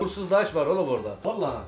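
normal talking voice

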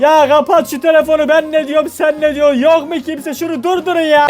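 ordinary talk

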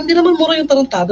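high, fast indignant retort; insisting to trolls it is no curse word; retold protest. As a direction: fire it back high and quick in protest. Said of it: "Sabi ko".

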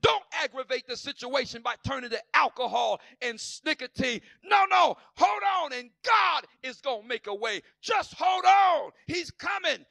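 fiery sing-song whoop, shouted high; preaching at full force. Acted. Preacher urging the church to hold on, in fiery whooping. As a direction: build the congregation up in a chanted whoop.

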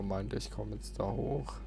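ordinary talk

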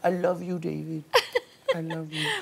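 hammed-up loving sigh to David; mock tearful Yolanda. Yolanda speaking to David, mock tender. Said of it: "can I do a Yolanda impression".

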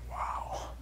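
breathless whispered wow; a frightened kid's whisper; stunned whisper. Retold or imitated. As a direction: whisper it, awestruck. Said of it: "I was like".

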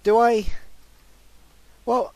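ordinary talk, nothing marked

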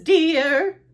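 high, loud motherly "dear"; mom's call. Acted. Mom to Froggy at breakfast, motherly call.

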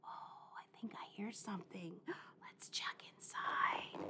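hushed whisper. Pretend. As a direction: whisper so he won't startle. Sneaking up on sleeping mouse.

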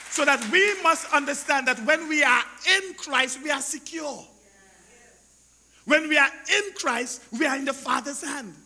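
emphatic, insistent sermon delivery; preacher declaring with conviction; forceful preaching. Real, not acted. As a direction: press the assurance on the congregation.

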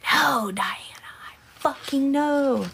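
emphatic whisper. Real, not acted. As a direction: whisper it like a secret to Diana. Whispering reply to a viewer.

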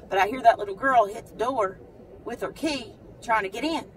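normal talking voice

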